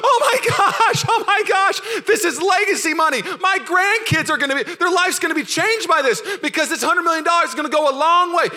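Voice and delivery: high, loud, laughing shriek of joy; ecstatic shout. Retold or imitated. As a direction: scream with joy over sudden riches. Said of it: "grab the necklace and be like".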